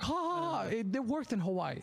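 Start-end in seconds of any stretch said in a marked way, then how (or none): 0.0-0.6 mock laugh. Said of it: "people were like".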